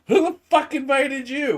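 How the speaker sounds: high, put-on outraged voice; mock hostile greeting. Friends mock-greeting the girlfriend who joins the call, high put-on outrage.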